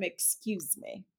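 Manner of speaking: slow, soft, careful "Excuse me" with each word laid out; polite teaching model. Shown as a demonstration. Mother teaching her son to say "Excuse me", slow and soft. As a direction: model "Excuse me" slowly and gently, as a lesson. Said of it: "I'm trying to teach him".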